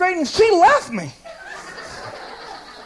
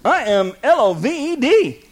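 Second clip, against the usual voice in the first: "L O V E D" spelled letter by letter, slowly; slower than usual. Acted. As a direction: spell out "L O V E D" one letter at a time. Spelling out loved for emphasis.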